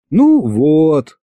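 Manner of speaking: high, drawn sigh of complaint. A narrator's reading. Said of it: "снова вздохнула Маша". (со вздохом, жалобно)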